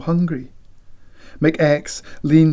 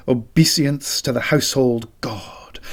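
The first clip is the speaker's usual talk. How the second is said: ordinary delivery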